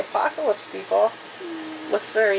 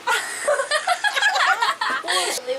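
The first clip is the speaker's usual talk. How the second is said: high-pitched laughing fit; real laughter; 13 semitones higher than usual. Real, not acted. Reader amused by the hair trail, laughing. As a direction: crack up high and long. Laughs at own bald joke.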